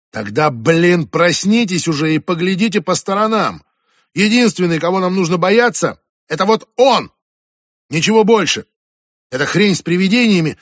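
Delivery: high, loud angry tirade; furious shouting. Acted. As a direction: shout them awake. Julia shouting at the others.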